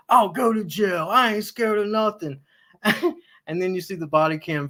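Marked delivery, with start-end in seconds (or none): 0.1-2.4 a lightly voiced boastful tough guy, pitch up; mock tough talk. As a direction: brag like an online tough guy. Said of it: "He's like".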